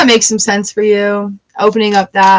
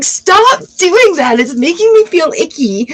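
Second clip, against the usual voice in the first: mock annoyed whine; 8 semitones higher than usual. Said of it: "I'm like".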